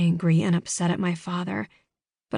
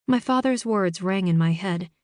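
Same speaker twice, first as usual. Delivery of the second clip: ordinary delivery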